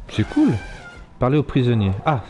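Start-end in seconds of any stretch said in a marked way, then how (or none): 0.1-0.5 delighted squeal. Real, not acted. Delighted at his mammoth.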